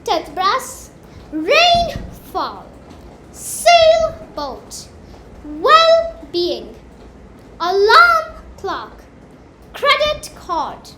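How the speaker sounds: loud drilled list, first part of each compound punched; loud classroom drill, stress on the first part; stress on the first word. Shown as a demonstration. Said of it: "Let's see some examples".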